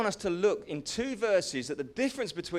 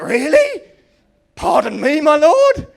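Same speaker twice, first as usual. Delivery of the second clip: much louder and higher, rising in disbelief; shocked outburst; 8 semitones higher, 17 dB louder than usual. Acted. Gideon told to drop his swords, crying out in disbelief. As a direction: protest in shock.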